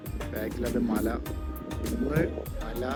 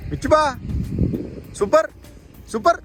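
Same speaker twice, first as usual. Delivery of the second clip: calling out; 11 semitones higher, 4 dB louder than usual. Real, not acted. Calling to companion.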